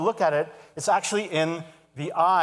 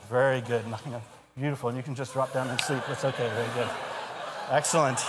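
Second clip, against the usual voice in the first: hypnotic induction; 3 semitones lower than usual. Acted. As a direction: lull the volunteers down into sleep. Hypnotist putting volunteers under.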